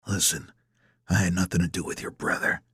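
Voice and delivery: low, soft, controlled denial; guarded antagonist. A narrator's reading. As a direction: deny it quietly and firmly.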